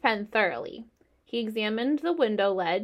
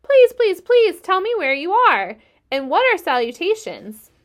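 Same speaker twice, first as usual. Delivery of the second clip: frantic pleading; 9 semitones higher, 11 dB louder than usual. A narrator's reading. Wilbur begging frantically.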